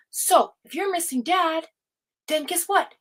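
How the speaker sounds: mother lightly imitating her son's calm explanation; voicing her son. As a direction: play the patient kid teaching mom. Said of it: "He said".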